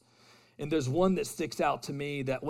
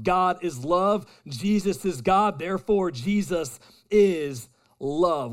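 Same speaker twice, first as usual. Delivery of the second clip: emphatic proclamation; 4 semitones higher, 7 dB louder than usual. Real, not acted. Preaching climax, building proclamation.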